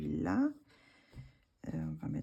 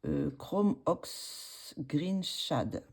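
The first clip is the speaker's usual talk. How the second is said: French-accented English; much slower than usual. Real, not acted. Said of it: "avec mon accent".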